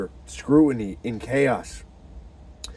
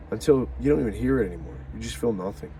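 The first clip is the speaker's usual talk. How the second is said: usual speaking voice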